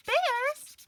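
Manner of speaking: gasping high rising question; high excited echo; delighted surprise. Acted. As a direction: gasp it back, thrilled. Gasps in delight at answer.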